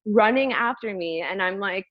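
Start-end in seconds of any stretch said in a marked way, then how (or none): none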